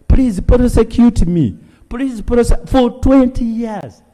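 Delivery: high, loud pleading voice; begging prisoner. Retold or imitated. MP imitates a jailed man begging the court, pleading. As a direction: beg like a forgotten prisoner. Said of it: "is now begging to be prosecuted".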